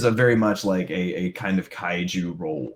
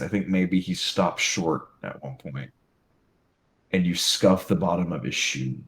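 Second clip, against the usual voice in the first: ordinary delivery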